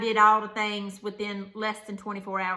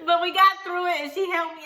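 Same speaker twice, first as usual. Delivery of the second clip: laughing high through tears; a teary laugh that breaks through; laughing while crying; 9 semitones higher, 6 dB louder than usual. Real, not acted. Recalling mom helping her, a teary laugh. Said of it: "we laughed while I cried".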